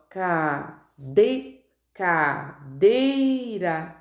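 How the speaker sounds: cadeira split slowly, DEI punched; drawn-out syllables with a heavy DEI in cadeira; sílaba tônica destacada. Shown as a demonstration. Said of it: "qual é a sílaba mais forte?". (cadeira silabada, com DEI bem forte)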